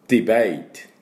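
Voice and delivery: ディベート modelled for students; model pronunciation. Shown as a demonstration. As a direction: say ディベート clearly for repeating. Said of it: "私の後について発音いきましょう".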